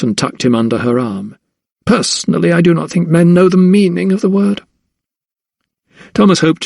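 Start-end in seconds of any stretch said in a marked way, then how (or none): 1.8-4.6 high-pitched sneering lady of the house; scornful mistress in a high voice; haughty lady, disdainful. Acted. Haughty lady figure continues.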